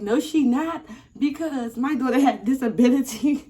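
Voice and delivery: high, loud mock mother; protesting mother. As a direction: imitate a mom arguing with police. Mother protesting to police, voiced.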